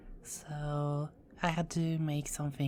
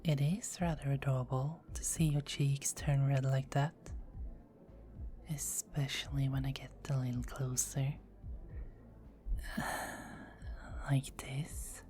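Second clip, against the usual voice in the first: soft intimate whisper; hushed flirtatious tease; 4 dB quieter than usual. Acted. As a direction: sigh and lean in close. Leaning closer, teasing.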